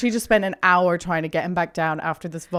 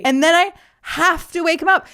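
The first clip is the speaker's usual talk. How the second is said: higher, louder exasperated complaint; indignant outburst; 8 semitones higher, 6 dB louder than usual. Real, not acted. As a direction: fume at the absurdity. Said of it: "indignant retelling".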